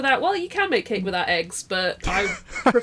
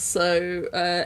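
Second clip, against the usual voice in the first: usual speaking voice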